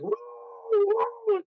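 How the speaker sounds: high, long mock howl like a hound; imitated howl. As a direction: howl it up high like the dog echoing. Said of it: "it was howling".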